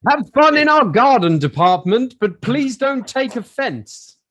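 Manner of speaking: loud, high-pitched tannoy pun; store announcer. Acted. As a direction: belt it out like a shop announcement.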